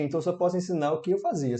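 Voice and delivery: usual speaking voice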